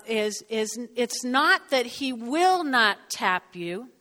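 ordinary talk

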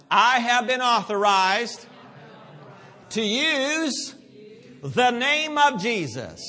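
high, loud, deliberate rallying call; loud declaration. Shown as a demonstration. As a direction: proclaim it out loud, phrase by phrase. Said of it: "One more time".

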